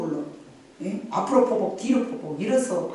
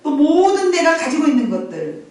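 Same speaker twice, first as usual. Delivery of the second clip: loud, raised preaching voice; full-force preaching; 6 semitones higher, 5 dB louder than usual. Acted. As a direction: proclaim it to the congregation. Preaching to the congregation. (힘찬 설교 톤)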